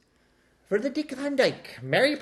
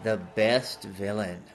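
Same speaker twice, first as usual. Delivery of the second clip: Matthew McConaughey impression; 10 semitones lower than usual. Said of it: "Matthew McConaughey".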